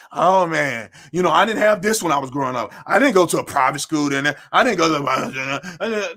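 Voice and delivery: low, grumbling mock whiner; mock someone making excuses in a low mumble; put-on sad-sack complaining, pitched well down; whining complainer. Mocking complainers, then "stop all that.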